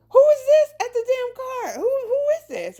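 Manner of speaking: high, loud puzzled voice calling out; a surprised God peering out of the car; startled God. Retold or imitated. God inside the car hearing banging, high startled call. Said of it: "And God is just like".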